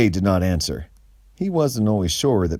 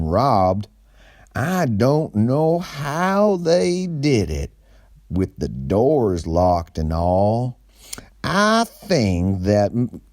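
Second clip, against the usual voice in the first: higher, slow awkward clerk; store manager voice; 4 semitones higher, slower than usual. Acted. Kenny tells Gray about the theft, higher and slow. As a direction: drag it out like a nervous witness. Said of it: "answered Kenny".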